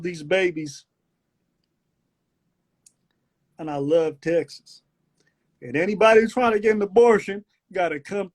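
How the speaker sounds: drawled Southern speech with long pauses; Southern country accent. Acted. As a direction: declare it like a proud country boy. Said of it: "what it is about that accent".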